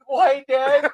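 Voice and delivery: put-on high, loud whiny kid asking why; a high, pleading kid's "Why, Dad?"; little kid voice. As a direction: play the confused child calling out to dad. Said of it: "I picture your kid going".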